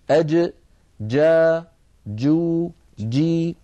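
أج جا جو جي with a firm dj in each; jim sound drill. Shown as a demonstration. Teacher drills the letter for students, clear syllables. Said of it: "هذا مكانها وهذا صوتها".

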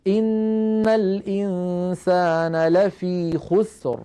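slow, drawn tajweed recitation with heavy r; تلاوة مرتلة بطيئة; Quran recitation. Shown as a demonstration. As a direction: chant the verse slowly and evenly. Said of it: "الآية التي بعدها".

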